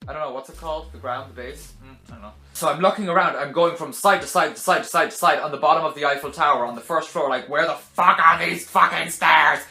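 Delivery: usual speaking voice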